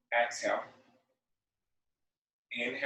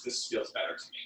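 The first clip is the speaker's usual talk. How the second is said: whispered aside; 5 semitones lower, 9 dB quieter, faster than usual. Real, not acted. Instructor aside while adjusting pose.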